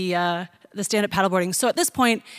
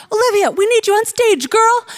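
urgent call; 12 semitones higher, 6 dB louder than usual. Retold or imitated. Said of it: "she's like".

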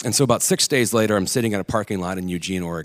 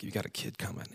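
hushed disapproving aside; 14 dB quieter than usual. Retold or imitated. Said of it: "people were like".

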